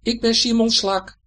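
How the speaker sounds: high, loud squeaky fib; called-back snail voice. A narrator's reading. Little friends pretending to be a snail to the pike, high squeak. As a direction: pipe it up as a tiny snail. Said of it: "Dan roepen ze terug".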